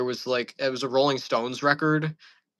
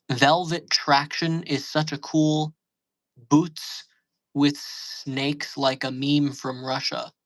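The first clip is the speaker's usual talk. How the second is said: slow reading; much slower than usual. Shown as a demonstration. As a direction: slowly read out, word by word. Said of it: "That sounds like some shit".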